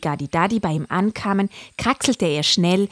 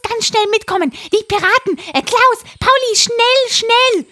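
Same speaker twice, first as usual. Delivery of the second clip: parrot voice; 8 dB louder than usual. Acted. Parrot character urgently calling.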